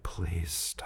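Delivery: low, soft, worn-out plea; weary plea. Retold or imitated. Customer privately begging the questioner to quit, wearily. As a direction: let it sink almost under your breath.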